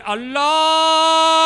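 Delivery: long, high, loud held cry; ancestral war cry. Acted. Iranian ancestors calling from the depths, a sustained shouted war cry. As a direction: bellow it out and hold it.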